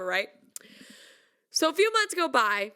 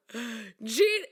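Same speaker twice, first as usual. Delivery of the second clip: ordinary talk, nothing marked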